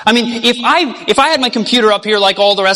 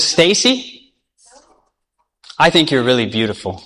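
woo her gently, voice dropped; tender proposal; 8 semitones lower than usual. Acted. Boy proposing to Stacy.

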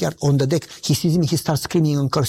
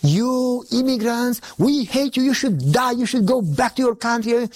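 high-pitched hateful rant, retold; screaming neighbor; 7 semitones higher, slower than usual. Neighbor cursing the immigrant next door, screaming rant. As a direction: imitate a raging neighbor's curses. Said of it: "screaming, calling me names".

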